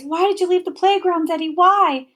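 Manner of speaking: a frightened mother crying out. A narrator's reading. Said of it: "She cries".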